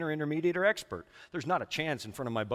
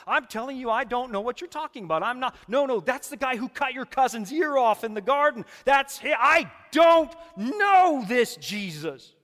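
desperate denial building to a shout; 10 semitones higher, 10 dB louder than usual. Acted. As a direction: deny him louder and louder, then drag the last line out. Peter's escalating denial.

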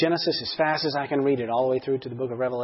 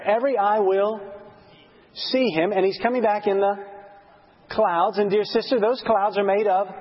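excited Bible teaching; 6 semitones higher than usual. Retold or imitated. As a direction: lay out the promise with rising excitement. Acting out an excited Bible study.

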